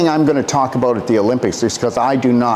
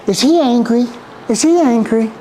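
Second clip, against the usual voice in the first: mocking worried onlookers; 9 semitones higher than usual. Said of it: "So people go".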